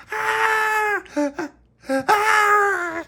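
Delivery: loud shrill screaming as she runs; high, loud fleeing screams; terrified screams. Acted. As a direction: scream while running away. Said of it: "came screaming past".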